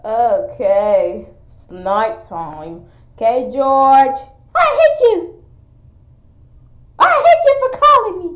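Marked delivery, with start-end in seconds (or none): 4.5-8.3 very high, fast little character voice; high cartoon kid striking back; squeaky pretend character. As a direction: play the cartoon kid, pitched way up and hurried.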